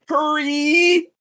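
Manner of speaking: high, loud mock yell; hammed-up urgent cry; shouted hurry. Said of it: "she's like".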